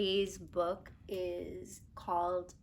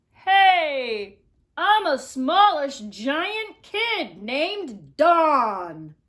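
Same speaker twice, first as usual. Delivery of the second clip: put-on louder, slow booming giant kid; 6 semitones higher, 9 dB louder, much slower than usual. Giant kid in the bathtub greets Jack, slow booming voice. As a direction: draw it out big and slow like a giant.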